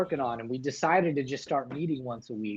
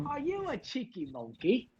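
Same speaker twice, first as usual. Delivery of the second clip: much higher hammed-up British accent, teasing; bad British accent; 9 semitones higher than usual. Host teasing the room in a hammed-up British accent. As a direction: tease like a cartoon Brit. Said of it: "Yeah, it's really bad".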